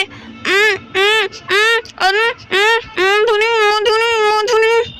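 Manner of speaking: muffled humming through a tied mouth; gagged mumbling. Acted. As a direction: try to talk with the mouth bound shut. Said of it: "बोला ही नहीं जा रहा". (बंधे मुंह से घुटी हुई उम-उम)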